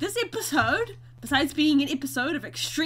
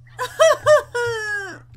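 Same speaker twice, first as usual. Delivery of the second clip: laughing cry; 10 semitones higher, 9 dB louder than usual. Real, not acted. Laughing at own disaster.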